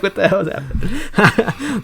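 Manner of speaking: slow, laughing disbelief. Retold or imitated. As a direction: drag it out through a laugh. Retold reaction, slow laugh.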